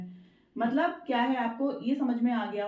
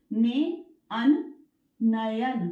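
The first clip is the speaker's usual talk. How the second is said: slow syllable split; 3 dB louder, much slower than usual. Shown as a demonstration. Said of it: "आय की आवाज आएगी".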